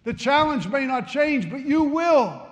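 high, loud climactic preaching; full-force preaching. Acted. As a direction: build it to the climax.

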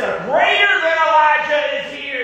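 high, loud, ringing proclamation; preacher shouts Jesus' words high and loud; proclaiming shout. Acted. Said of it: "is what Jesus said".

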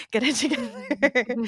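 slow, laughing, to herself; laughing self-scolding. Retold or imitated. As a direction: giggle through the words. Telling herself to stop crying.

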